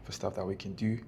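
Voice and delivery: hushed, half-whispered voice; library whisper. Real, not acted. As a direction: keep it down so nobody hears. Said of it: "I'm whispering 'cause people come into work".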